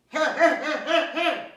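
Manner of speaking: loud, high bursts in the ear; pig noises. Acted. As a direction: play a piglet blasting noisy bursts. Cerdita hace ruido al perro. (ruidos de cerdita, fuertes y agudos)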